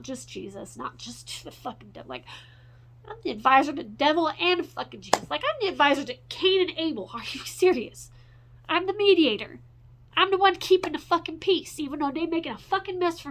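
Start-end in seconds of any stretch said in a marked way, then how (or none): 3.1-13.3 louder and higher, building into a furious outburst; real exasperated yelling at the world; angry rant. Real, not acted. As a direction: vent it, getting angrier with every line. Ranting about being everyone's mediator.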